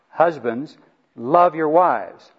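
slow repeated command, hitting "love"; emphatic command. Real, not acted. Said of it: "Three times it says this".

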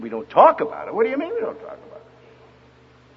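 mock disbelief rising to a high question; incredulous echo. Preacher echoing a girl's refusal to him, incredulous. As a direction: throw it back as an astonished, climbing question.

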